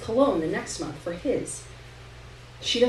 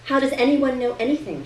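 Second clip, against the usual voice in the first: flippant; 4 semitones higher, 4 dB louder than usual. A narrator's reading. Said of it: "a perfect replica of Dad's flippant responses".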